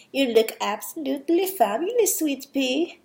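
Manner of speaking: put-on hammy Dutch-accented mother; attempted Dutch accent. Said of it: "sorry for my awful accent".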